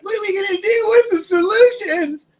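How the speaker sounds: high whiny mock-helpless voice; mocking falsetto wail; panicked public. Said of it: "People are like".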